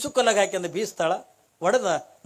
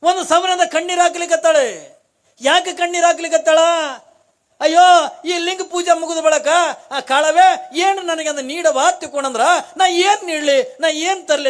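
higher, louder grieving sermon cry; fervent lament; 9 semitones higher, 8 dB louder than usual. Acted. Storyteller voicing the widow's grief to devotees, fervent lament. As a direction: wail her worry out to the listeners.

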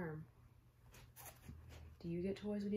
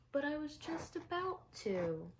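put-on much higher boyish voice for Spencer; a small boy protesting in disbelief; high little boy; 9 semitones higher than usual. Little Spencer to his mom, high boyish disbelief.

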